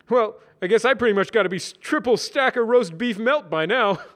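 high, loud jokey voice; Brad voice. Acted. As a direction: joke about it loudly.

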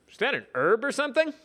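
high, puzzled question. Acted. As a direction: ask it baffled. Brad voiced, puzzled.

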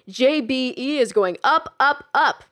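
slow, loud showman slogan; infomercial presenter. Acted. Jack pitching his new slogan to Kelly, loud and slow like an infomercial. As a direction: sell it like a TV pitchman. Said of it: "like a presenter on one of his infomercials".

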